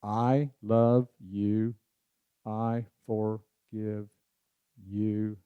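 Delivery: very slow, soft, drawn-out phrases; slow trial recital. Shown as a demonstration. Speaker trying the phrase for ten count, slow and soft. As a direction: feel out the rhythm slowly. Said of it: "and I thought, I wonder".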